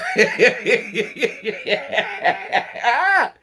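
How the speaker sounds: loud burst of real laughter; cracking up at the story. Real, not acted. Cracking up at Mary's line to the friend.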